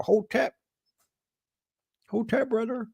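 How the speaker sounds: mock greeting. Mocking old doubters now greeting.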